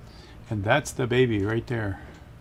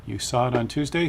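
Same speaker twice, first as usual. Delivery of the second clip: ordinary talk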